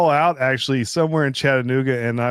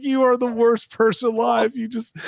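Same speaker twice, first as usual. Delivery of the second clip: much higher, amused mock outrage; laughing outrage; 11 semitones higher than usual. Telling friend he's terrible, high laughing voice. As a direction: scold him while cracking up. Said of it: "I was like".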